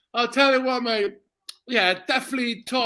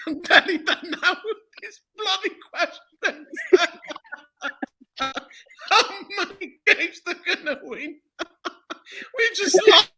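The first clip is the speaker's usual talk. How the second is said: giggling through the words; can barely get it out for laughing; slowed, laughing disbelief; 7 semitones higher than usual. Real, not acted. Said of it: "I'm cracking up".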